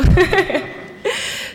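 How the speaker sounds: breathy high nervous giggle; sheepish giggle from nerves; nervous laugh. Real, not acted. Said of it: "I'm a little nervous".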